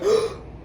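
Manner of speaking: a quick breathy gasp of shock; sharp gasp. Retold or imitated. Patient flinching at the needle, sharp gasp. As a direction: suck in air as the needle hits.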